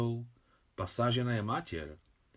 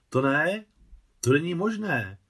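high, louder confused protest; flustered and thrown, the words tumble out; 6 semitones higher, 6 dB louder than usual. A narrator's reading. Aunt thrown by the wedding news, flustered protest. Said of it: "řekla zmateně teta Nusia".

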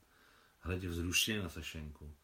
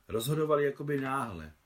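ordinary delivery, nothing marked

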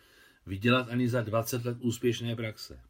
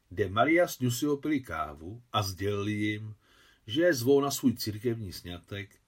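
plain reading voice

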